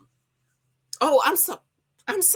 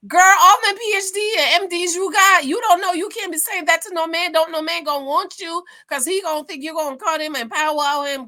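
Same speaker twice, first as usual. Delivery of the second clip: much higher, louder scolding; 6 semitones higher, 5 dB louder than usual. Real, not acted. To viewers about April, loud scolding. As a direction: tell her off.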